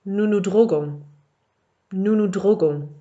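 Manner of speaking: Nous nous droguons read twice, clearly; model French phrase. Shown as a demonstration. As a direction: read it out for the class. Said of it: "Numéro trois".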